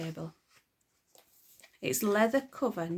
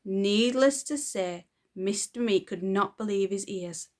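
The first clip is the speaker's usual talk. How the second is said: usual speaking voice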